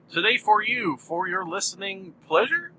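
"pleasure" lifted at the end like a question; questioning rise. Real, not acted. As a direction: let "pleasure" swing up into an unsure question. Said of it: "my voice went up at the end".

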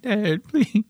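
high, imitating a sobbing beg; a hammed-up tearful plea; crying plea. Clutching dad's boots, retelling a mock sob. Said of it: "I started fucking crying".

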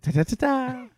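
high-pitched leprechaun babble; a hint of a giggly leprechaun. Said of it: "Sound like a leprechaun".

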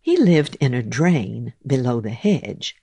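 plain reading voice

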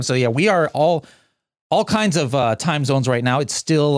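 usual speaking voice

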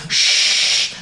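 long hushing water imitation; running water noise. Friend doing dishes on speakerphone, water noise.

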